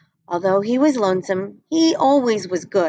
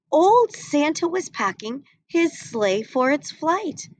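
usual speaking voice

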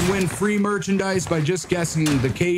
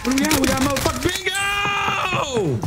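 building to a high yell; excited shout; 11 semitones higher, slower than usual. Real, not acted. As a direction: build up and explode in triumph. Bingo celebration.

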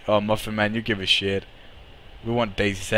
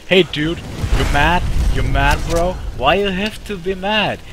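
higher, sing-song put-on voice to tease; mocking taunt; 5 semitones higher than usual. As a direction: goad the enemy like a bully.

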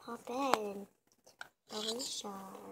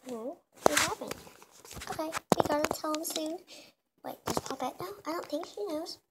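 ordinary talk

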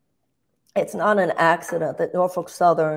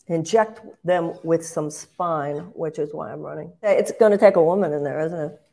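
ordinary delivery

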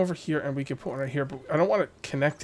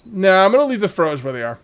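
louder, emphatic first take; firm decision; 4 semitones higher, 8 dB louder than usual. Real, not acted. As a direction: announce it firmly. Decides aloud, then repeats quietly.

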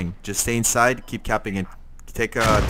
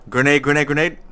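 loud, higher urgent warning yell; grenade warning yell; 5 semitones higher, 7 dB louder than usual. Real, not acted. Grenade incoming in firefight.

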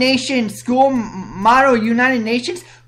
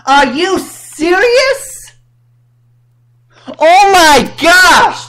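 disbelief; 7 semitones higher, 4 dB louder, slower than usual. Real, not acted. Reacting to victim-blaming.

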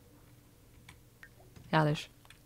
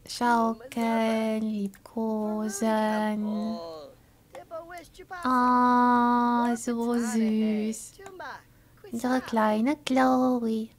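tender; 3 dB quieter, much slower than usual. Acted. Reading actions tenderly to baby.

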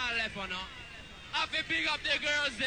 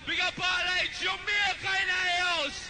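crowd hype shout; 6 semitones higher, 5 dB louder than usual. Real, not acted. MC hyping the crowd.

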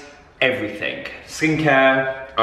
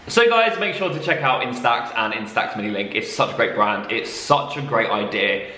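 usual speaking voice

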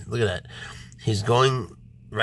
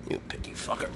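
whisper; 8 dB quieter than usual. Real, not acted. Muttered insult to snake.

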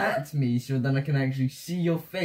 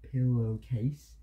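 PILLOWCASE drawn out slowly and softer; slow demonstration; 7 semitones lower, 5 dB quieter, slower than usual. Shown as a demonstration. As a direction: stretch out each part of "Pillowcase" for a lip-reader. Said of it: "Look, ready? This is pillowcase".

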